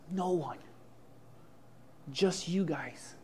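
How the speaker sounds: slow, soft whisper; whispered aside. Real, not acted. Pastor whispering an aside to the congregation, slow whisper. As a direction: lean in and whisper it slowly.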